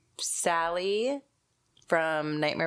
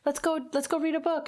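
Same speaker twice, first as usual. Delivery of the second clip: put-on high-pitched sing-song to a small child; coaxing parent; 11 semitones higher than usual. Mother lures her daughter before a scare, high coaxing voice. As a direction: coax a little one along, much higher.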